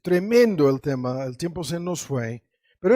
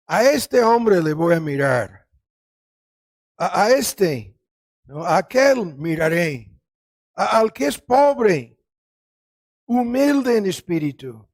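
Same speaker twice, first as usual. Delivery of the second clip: raised, emphatic voice of God; God speaking; 5 dB louder than usual. Retold or imitated. Preacher quotes God to the congregation, emphatic proclamation. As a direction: proclaim it loudly as God's own declaration. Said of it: "Dios dice".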